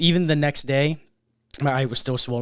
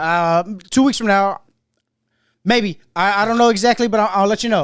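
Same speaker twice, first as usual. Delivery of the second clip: put-on Memphis accent; 7 semitones higher, 9 dB louder than usual. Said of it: "He got a fucking accent".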